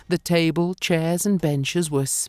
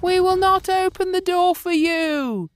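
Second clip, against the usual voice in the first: put-on high, loud children's refusal; goat kids shouting; 3 dB louder than usual. A narrator's reading. The kids shout at the wolf through the door, high and loud. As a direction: shout back at the wolf in high kid voices. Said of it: "they shouted".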